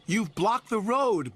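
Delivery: high, slow, cross snap; snapped. Acted. Bertie snapping at Percy for blocking the road. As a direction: snap it at the engine in your way. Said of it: "Bertie snapped".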